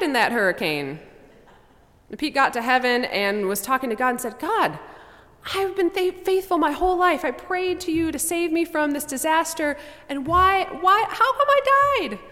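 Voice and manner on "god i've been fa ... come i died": high, whiny complaint to God; aggrieved complaint. Acted. Pete complains to God in heaven, high and whiny. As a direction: protest like a wronged believer.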